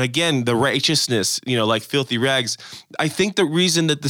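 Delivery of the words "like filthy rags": ordinary delivery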